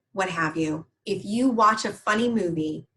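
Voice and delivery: ordinary delivery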